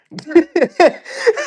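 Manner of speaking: big high cackling laugh; loud burst of laughter. Real, not acted. Laughing at his own stove answer.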